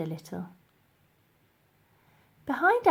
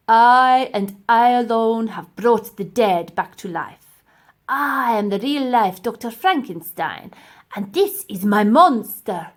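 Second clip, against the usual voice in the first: loud, slow megalomaniac boast; grandiose professor; 2 semitones higher, 10 dB louder than usual. Acted. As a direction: proclaim like a mad scientist.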